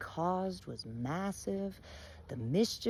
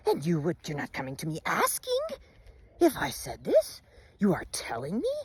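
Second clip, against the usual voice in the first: outraged Bear. Acted. Bear confronting Wolf, outraged and shouting. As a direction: roar your offense at the accuser. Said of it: "Bear sits up and goes".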